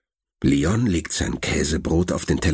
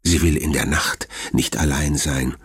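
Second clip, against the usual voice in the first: plain reading voice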